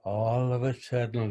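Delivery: plain reading voice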